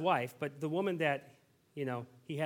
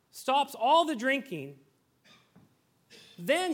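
higher, louder, building sermon cadence; preaching at full force; 9 semitones higher, 6 dB louder than usual. Real, not acted. Pastor pressing the congregation on earning God's favour, fervent preaching. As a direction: drive each "unless" home from the pulpit.

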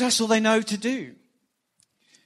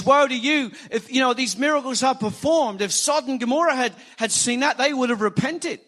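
raised, forceful preacher's denunciation; scathing rebuke; 6 semitones higher, 5 dB louder than usual. Retold or imitated. Preacher quoting Jesus rebuking unrepentant towns, scathing. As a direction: press the warning home, high and emphatic. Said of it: "this is pretty scathing words".

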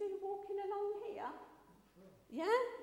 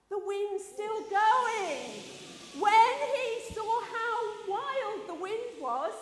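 loud, high, drawn-out storytelling; raised voice over a storm; dramatic storm narration; 5 semitones higher, 9 dB louder, much slower than usual. A narrator's reading. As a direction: build the danger with big slow words. Wild wind, dramatic storytelling.

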